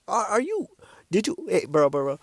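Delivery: ordinary talk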